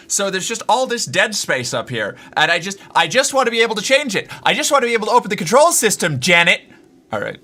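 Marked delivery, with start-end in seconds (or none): 2.4-6.6 louder, fast frustrated rant; exasperated rant. Real, not acted. Streamer to chat about the camera controls, fast exasperated rant. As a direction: vent it, faster and faster.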